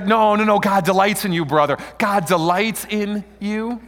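loud, high, hearty brushing-off; mock insistence. Brushing off a friend who corrects the name, hearty and loud. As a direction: cheerfully wave away the correction. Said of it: "If they try to".

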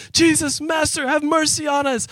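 high-pitched mock cry for mercy; lepers shouting. Lepers calling to Jesus from afar, high mock cry. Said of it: "ten lepers start shouting".

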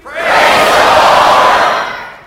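congregation shouting as loud as possible; long, drawn-out group shout; joyful shout. Shown as a demonstration. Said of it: "as loud as we can".

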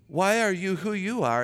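normal talking voice

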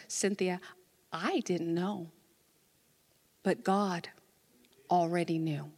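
low, soft, measured reply; retold calm pastoral answer; gentle assurance. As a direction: answer quietly, pausing before the reveal.

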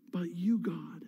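soft, near-whispered affirmation; hushed refrain. Acted. Preacher answering fear with trust, hushed. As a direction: let it fall to a reverent hush.

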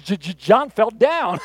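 slow imitated stammer; speech impediment. Bob answering the faith healer, mock slow stammer. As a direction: stutter on the first sound, then drag it out. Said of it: "has a bad impediment".

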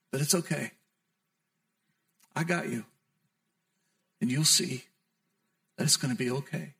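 soft, low, gentle voice of forgiveness; tender forgiveness. Acted. Jesus softly forgives Peter after the denial, tender and low. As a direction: speak to him softly, like a friend who forgives.

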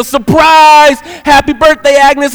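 high, loud group-greeting shout; yelled surprise. Retold or imitated. Diner crowd greets Agnes at her party, yelling. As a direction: shout it out as she walks in. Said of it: "and they yell".

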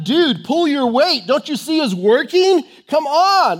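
high, loud, fed-up demand; exasperated attitude. Acted. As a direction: nag a slacker to pitch in. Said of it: "that's the attitude behind this".

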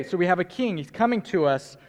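usual speaking voice

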